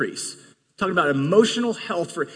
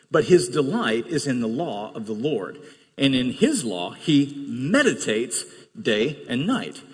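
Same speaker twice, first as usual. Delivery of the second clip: scripture reading; 4 semitones lower than usual. Acted. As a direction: read the psalm gently and slowly. Reads Psalm one aloud.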